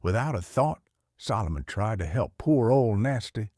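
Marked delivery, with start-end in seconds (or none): none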